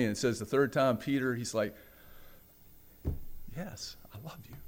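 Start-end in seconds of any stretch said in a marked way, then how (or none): 3.6-4.6 whispered, very soft reply; voiceless, halting confession; hushed answer. Retold or imitated. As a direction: barely get it out in a whisper. Peter's third answer, whispered.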